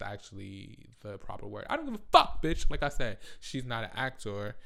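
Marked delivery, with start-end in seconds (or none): none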